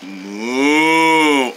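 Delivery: long, low mooing; cow moo. Acted. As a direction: imitate a cow's drawn-out moo. Imitates cow, next line names it.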